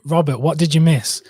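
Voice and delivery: normal talking voice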